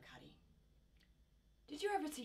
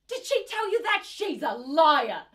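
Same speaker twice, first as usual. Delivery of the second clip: outraged denial; 5 semitones higher, 8 dB louder than usual. Acted. Mother denying abuse to the court, outraged. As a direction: erupt at the accusation.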